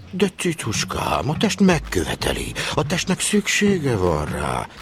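high, slow, crooning lover's voice; overheard seducer. Retold or imitated. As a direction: coax her like a sly old charmer. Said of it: "azt hallottam, hogy". (magas, lassú, búgó csábító hang)

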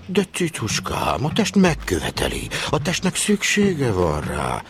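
high, slow, coaxing woman's voice. Retold or imitated. Overheard lady coaxes Hubicska, high and slow. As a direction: purr it like a seductress behind the door. Retold woman's voice behind door.

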